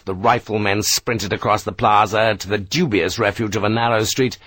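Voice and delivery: usual speaking voice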